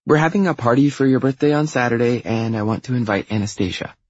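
bossy younger sister, pitch lifted; pushy little sister. Acted. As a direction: announce it high and insistent.

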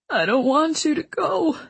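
high, tearful plea; tearful moan. Acted. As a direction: beg her not to leave, near tears. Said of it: "he moaned".

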